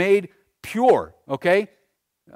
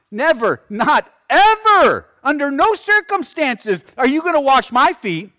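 loud, high, adamant protest; emphatic refusal; 10 semitones higher, 8 dB louder than usual. Acted. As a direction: hammer NEVER and EVER, drawing out EVER. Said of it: "puts emphasis on it".

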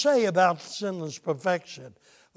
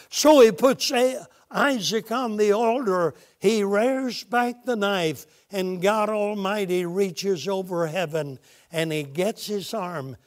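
ordinary talk, nothing marked

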